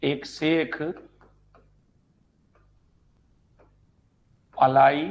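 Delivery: slow dictation, long pauses between each chunk; teacher dictating to a class, pausing for pens; dictating notes. Shown as a demonstration. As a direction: read it out phrase by phrase so students can write.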